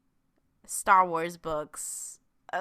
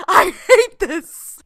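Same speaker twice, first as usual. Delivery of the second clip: laughing loud and slow through the words; a real laugh that breaks into a groan of mock despair; I hate this, laughed out helplessly; 11 semitones higher, 17 dB louder, slower than usual. Real, not acted. Crying laughing over a book.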